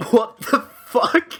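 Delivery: slow, high disbelieving; baffled. Retold or imitated. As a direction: drag it out in disbelief. Retelling own confused reaction.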